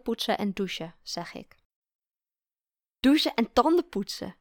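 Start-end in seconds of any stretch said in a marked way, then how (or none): 3.0-4.4 louder, higher, lightly voiced amusement; amused. A narrator's reading. Partner correcting the order of bedtime routine, amused. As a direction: repeat it as a delighted correction, without real laughter. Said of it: "zegt ze lachend".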